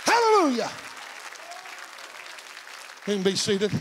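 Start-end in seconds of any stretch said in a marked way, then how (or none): 0.0-0.7 high, driving preacher's cry; full-force preaching. Real, not acted. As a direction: build to long, high shouted praise words.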